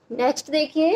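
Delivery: normal talking voice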